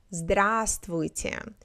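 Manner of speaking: здравствуйте drawn out slowly, first в silent. Shown as a demonstration. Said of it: "we don't pronounce the V in the middle".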